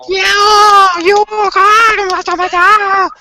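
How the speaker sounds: high, slow cheering shout; hammed-up crowd cheer; mock cheering crowd. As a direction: cheer them on loudly.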